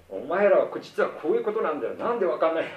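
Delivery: a light Tora-san sermon to the family; Tora-san lecturing. Retold or imitated. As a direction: lay it out with know-it-all gusto. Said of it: "って感じで".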